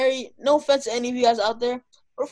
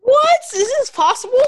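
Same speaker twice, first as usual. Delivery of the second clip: stunned disbelief; 13 semitones higher, 8 dB louder than usual. Real, not acted. Shocked at playoff wins stat.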